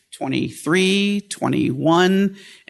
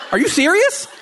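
much higher, incredulous and amused; stunned disbelief; 13 semitones higher, 3 dB louder than usual. Retold or imitated. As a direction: squeak it out in disbelief. Own stunned reaction, retold.

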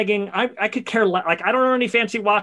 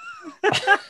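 sudden high squealing laugh; high laughing burst; high burst of laughter. Real, not acted. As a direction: crack up high.

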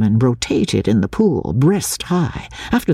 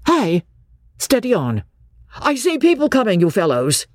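a hint of an alarmed warning; changed voice; 7 semitones higher than usual. A narrator's reading. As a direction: warn them, hurried. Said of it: "Then his voice changed".

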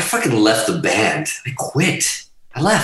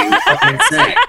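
laughing squeal; 3 dB louder than usual. Retold or imitated. Joking retelling, laughing.

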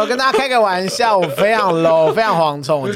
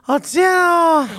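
mock surprise; 10 semitones higher than usual. Mock reaction to gossip.

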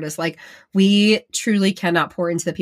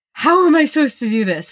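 higher, despairing question; exasperated; 8 semitones higher, 4 dB louder than usual. Retold or imitated. Parent on a hard day, asking in exasperation. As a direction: throw up your hands.

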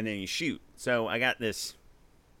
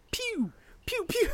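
high squeaky pew pew; child's toy gun pew; slower than usual. Retold or imitated. Little kid playing with a toy pistol, high squeaky pew pew. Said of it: "I just keep going".

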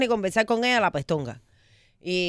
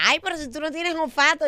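higher, hammed-up woman's protest; mock woman's voice; 7 semitones higher than usual. Joke punchline, the woman protests in a higher mock voice. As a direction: squeak out her surprised reply. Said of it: "Y dice ella".

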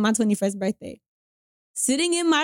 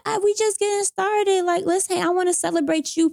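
higher-pitched, excited quote of a friend; retold eager invitation, pitched up; 8 semitones higher than usual. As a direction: imitate a friend keen to celebrate.

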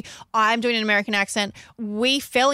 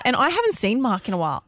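normal talking voice

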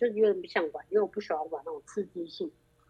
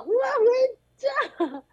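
high, loud, drawn-out panicked wail; keeps yelling; 4 dB louder, slower than usual. Retold or imitated. Ignoring the friend's hand, still wailing to go home, high and loud. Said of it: "继续喊".

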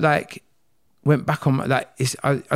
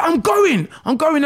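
angry retort; 4 dB louder, slower than usual. Retold or imitated. Storming off in an argument.

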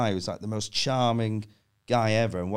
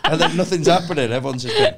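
ordinary delivery

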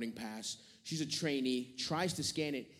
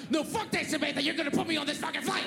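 very high-pitched hammed-up meltdown; losing it; 13 semitones higher, faster than usual. Passenger exploding at kiosk worker Samantha, losing it. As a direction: lose it in a high, screechy mock rage. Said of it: "and I just lost it".

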